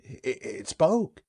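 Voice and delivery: slow, stunned stutter; stammering. Acted. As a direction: stammer it out in shock.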